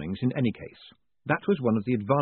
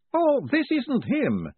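high, slow, cheery scholar; cheerful professor; 14 semitones higher, 3 dB louder, slower than usual. Acted. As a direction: brightly reassure in a high voice. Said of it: "said the Professor cheerfully".

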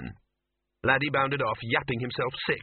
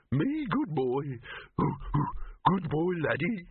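higher-pitched, eager doggy voice; simple-minded dog; 5 semitones higher, slower than usual. Acted. Dog Laddie greeting the others, simple doggy voice. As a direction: play a dim, devoted dog.

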